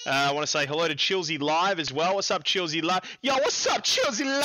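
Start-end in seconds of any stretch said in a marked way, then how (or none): 3.2-4.4 high-pitched hype greeting; hyped shout-out. Pretend. Host greets a viewer on the stream, hyped shout-out.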